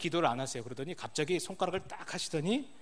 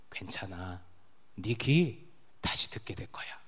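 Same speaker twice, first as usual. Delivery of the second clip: gentle whisper; 6 semitones lower, slower than usual. Pretend. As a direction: hush him like a frightened child. Jesus comforting the deaf man.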